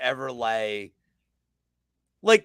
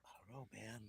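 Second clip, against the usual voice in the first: whisper; 5 semitones lower, 30 dB quieter than usual. Retold or imitated. His own doubting reply, retold.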